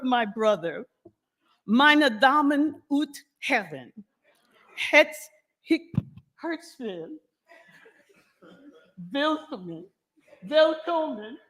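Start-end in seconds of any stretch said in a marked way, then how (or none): 1.6-11.4 halting German announcement, stumbling on the name; a hint of German host voice; attempted German. Shown as a demonstration. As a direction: try an announcer's German and fumble it. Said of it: "I knew I wasn't gonna do it right".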